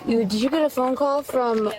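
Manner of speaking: ordinary talk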